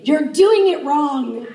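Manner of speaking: a hammed-up reprimand; mock scolding. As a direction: spell out the correction sternly. Scolding the couple in bed.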